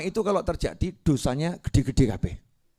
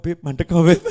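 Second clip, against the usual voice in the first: a louder, insistent devil nudge; devil's urging; 4 semitones higher, 8 dB louder than usual. Retold or imitated. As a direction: press him to stop, devil-like. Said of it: "kata setannya". (bisikan setan yang mendesak lebih keras)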